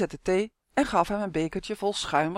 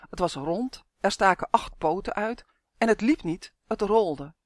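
ordinary delivery

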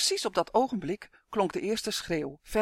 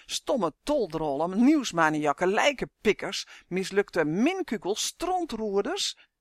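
slow, emphatic name-calling; venting insults; 2 semitones higher, 3 dB louder, slower than usual. A narrator's reading. Captive curses the vanished twins, venting angrily. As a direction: hurl each insult with relish. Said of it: "alle scheldwoorden te roepen die hij kende".

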